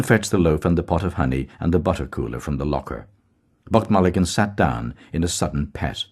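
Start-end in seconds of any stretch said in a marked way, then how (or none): none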